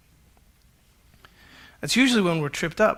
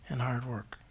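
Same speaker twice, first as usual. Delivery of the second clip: whispered; 7 semitones lower, 15 dB quieter than usual. Real, not acted. Preacher closing his list on mastery, whispered. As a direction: whisper the last words, trailing away.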